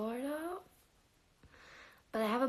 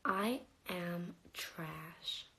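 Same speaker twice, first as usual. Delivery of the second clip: drawn-out, low, deadpan declaration; slow deadpan; 4 semitones lower, 6 dB quieter, much slower than usual. Pretend. Repeating her joke to viewers as a slow deadpan. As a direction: say it slowly, flat and low. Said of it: "I'm trash".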